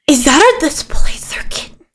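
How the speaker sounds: hushed, incredulous whisper; awed whisper. Acted. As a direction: whisper in amazed disbelief. Huck in awe of kitten.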